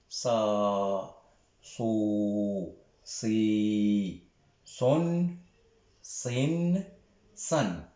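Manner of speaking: "Sa, so, si, as, sa, so, si, son, sin, san" read slowly as a teaching drill; teacher repeating the sad syllables. Shown as a demonstration. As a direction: model each syllable one by one. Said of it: "Je répète".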